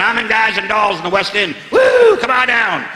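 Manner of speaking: high, slow hammed-up showman whoop; showbiz Don Johnson. Don Johnson in his linen suit, calling out to the crowd, hammed-up stagey whoop. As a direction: ham up a stagey West End star, drawing out the woo. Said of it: "Big blue linen suit going".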